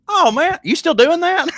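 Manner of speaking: the friend surprised he still does stand-up; mock astonished friend, pitched high and loud. Friend's surprised question in story.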